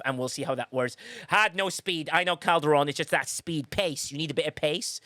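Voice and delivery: normal talking voice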